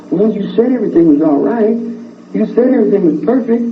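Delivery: high-pitched retold complaint; imitated accuser, high and insistent; accusing protest. Voicing the killer's accusing reply.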